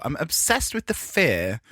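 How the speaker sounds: ordinary talk, nothing marked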